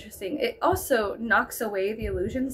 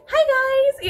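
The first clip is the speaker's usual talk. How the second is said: higher, louder bubbly TV greeting; 12 semitones higher, 7 dB louder than usual. Retold or imitated. Girl snapping back to her TV persona, bubbly greeting. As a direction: switch on the cheerful presenter voice. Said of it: "went right back to".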